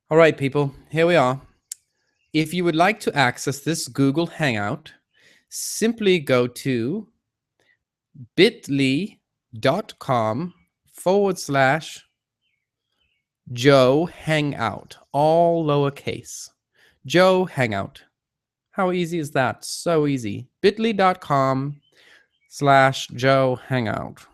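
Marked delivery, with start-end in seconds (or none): 2.3-18.0 slow, deliberate announcement; measured dictation, each part set apart; dictating an address. Real, not acted. Dictating the web address to viewers. 20.6-24.3 slow, emphatic repeat of a web address; deliberate, spaced-out dictation; dictating an address. Real, not acted.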